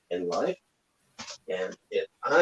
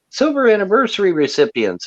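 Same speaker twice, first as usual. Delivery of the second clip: scornful repeat; 10 semitones higher, 5 dB louder than usual. Real, not acted. Repeats phrase louder with scorn.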